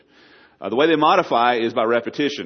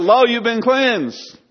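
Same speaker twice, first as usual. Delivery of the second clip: much higher and slow, drawn-out and soaring; exalted proclamation; 12 semitones higher, 6 dB louder, much slower than usual. Acted. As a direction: lift each word up like a blessing from above. Climax of heaven's answer.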